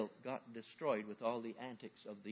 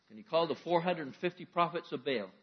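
ordinary talk, nothing marked

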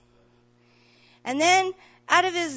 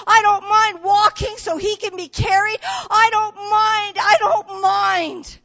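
loud, higher, fervent sermon delivery; a rousing, louder preacher's call to care for others; preaching at full force; 6 semitones higher, 8 dB louder than usual. Acted. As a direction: preach it out to the whole church, climbing. Preacher building to a climax.

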